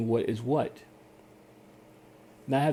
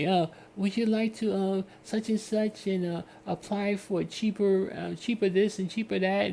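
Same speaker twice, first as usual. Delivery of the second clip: higher salesy pitch voice; pushy telemarketer; 8 semitones higher than usual. Retold or imitated. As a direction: pitch it like a cheerful caller. Voices telemarketer calling him.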